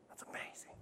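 hushed, voiceless amazement; barely audible marvelling; awed whisper. Real, not acted. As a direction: whisper it in wonder. Preacher marvels in a hush.

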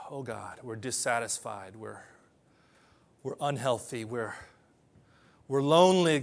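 hushed altar-call prayer; closing prayer. Acted. Pastor leads closing prayer.

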